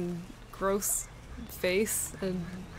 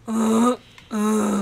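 deer grunt; 2 semitones higher, 10 dB louder than usual. Retold or imitated. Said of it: "I hope they go".

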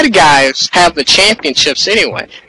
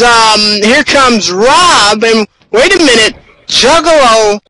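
urgent ringside commentary, a touch higher; live play-by-play; 6 semitones higher than usual. Acted. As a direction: call the action as it breaks.

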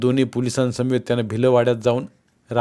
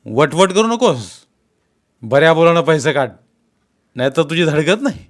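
loud, raised-pitch warning; stern threat; 4 semitones higher, 6 dB louder than usual. A narrator's reading. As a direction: cut off the excuses and threaten. Policeman threatens the suspect.

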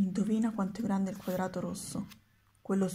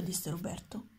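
plain reading voice

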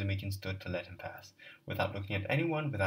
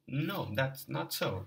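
slow echo; 5 semitones higher, much slower than usual. Acted. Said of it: "echoed Sazonov".

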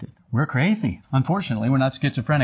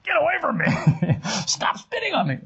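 much higher, squealing get-away-from-me voice, with a laugh; disgusted bystander; 10 semitones higher than usual. Retold or imitated. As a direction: play a grossed-out onlooker shrinking from spit. Bystanders being spat on.

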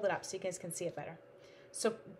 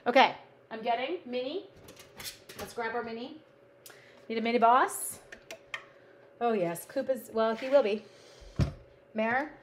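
ordinary talk, nothing marked

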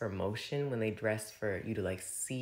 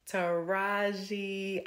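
drawn-out name call; 9 semitones higher, much slower than usual. Acted.

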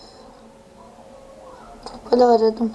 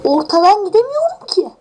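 higher, whiny near-tears complaint; frustrated whine; 7 semitones higher, 4 dB louder than usual. Real, not acted. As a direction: whine like you're about to cry. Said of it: "ağlayacağım lan".

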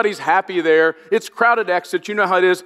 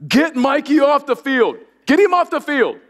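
high, mock frantic shout from the bleachers; a mother's panicked call to pull her son off the field; retold shouting; 8 semitones higher than usual. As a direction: play the wife yelling, pitched up high. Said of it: "my wife starts shouting".

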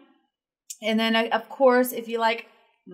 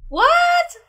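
sudden high shocked cry; blurted in disbelief; shocked what; 6 dB louder than usual. Real, not acted. Reacting as the song suddenly stops, shocked.

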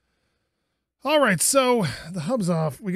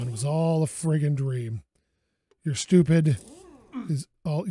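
usual speaking voice

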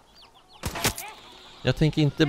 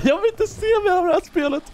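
very high put-on voice, scared and fed up; imitating girlfriend. Girlfriend on the sofa refusing the game, high squeaky plea. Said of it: "hon bara".

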